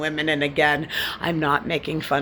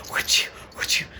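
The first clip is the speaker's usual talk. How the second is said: whispered taunt. Acted. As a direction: breathe it as a mocking whisper. Whispered aside.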